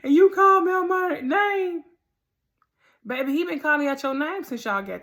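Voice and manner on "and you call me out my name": high, loud mock outrage; a boyfriend's loud indignant retort in an argument; mock indignant shout. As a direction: throw it back in mock outrage. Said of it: "he say that".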